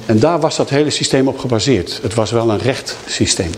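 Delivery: ordinary talk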